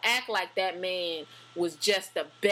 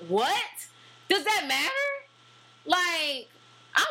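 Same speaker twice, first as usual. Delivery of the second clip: incredulous; 10 semitones higher, 3 dB louder, slower than usual. Real, not acted. Outraged at a debate remark.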